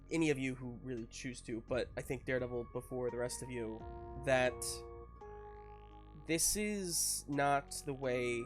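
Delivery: ordinary delivery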